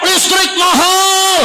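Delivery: high, long-drawn yell of the people; slow, stretched protest shout; protest cry. Retold or imitated. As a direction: imitate citizens crying out, held high. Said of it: "Rakyat teriak".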